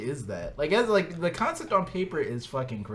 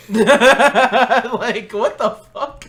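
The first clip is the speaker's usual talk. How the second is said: laughing; 10 semitones higher, 16 dB louder, much slower than usual. Real, not acted. Laughing at absurd song.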